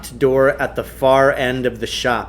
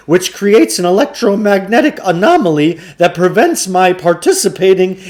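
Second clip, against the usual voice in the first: higher, louder breathless showman; excited huckster; 6 semitones higher, 6 dB louder than usual. A narrator's reading. Shopkeeper bragging about his Tibetan machine, high excited pitch. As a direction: spin a wild tale like a carnival barker. Klugarsh voiced in story.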